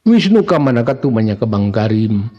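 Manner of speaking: ordinary delivery, nothing marked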